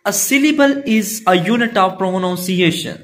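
unhurried textbook read; slow reading. Acted. Teacher reading the definition to pupils, slow.

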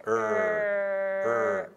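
high, drawn-out closed うー, held back in the mouth; closed vowel demo. Shown as a demonstration. Teacher showing the student the closed American vowel. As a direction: close the mouth almost fully and hold うー. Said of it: "閉じていいですよ".

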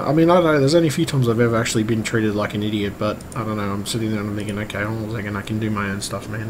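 usual speaking voice

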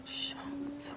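whispered "shocked". Real, not acted. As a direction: hush it in disbelief.

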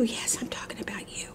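soft, near-whispered pet talk; hushed teasing. Real, not acted. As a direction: drop to a murmur for the puppy. Repeats it softly to the dog.

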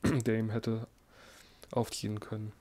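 ordinary delivery, nothing marked

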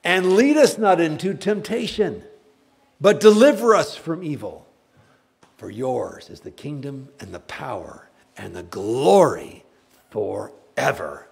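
loud, high, then slowing; fervent prayer. Real, not acted. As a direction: pray it out, slowing at the end. Lord's Prayer ending.

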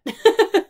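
a sudden loud, high laugh. Real, not acted. Joking about baby fever, a loud high laugh. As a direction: burst out laughing at yourself. Laughs at own clucky joke.

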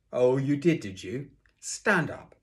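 loud, high, sarcastic challenge and order; angry teacher. A narrator's reading. Teacher turning on Sydney, angry. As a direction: round on him sharply.

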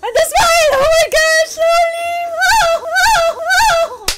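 high, loud wailing excitement; overwhelmed. Real, not acted. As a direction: cry out in awe, held high. Overwhelmed fan reacting to anime.

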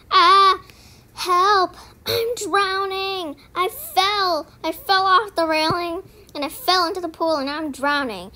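playful fake distress; mock panic. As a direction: pretend to be drowning, over the top.

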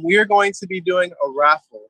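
ordinary talk

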